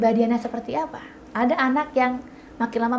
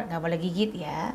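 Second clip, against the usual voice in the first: soft plain warning; 6 semitones lower, 7 dB quieter than usual. Shown as a demonstration. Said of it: "nggak bisa cuma sekedar".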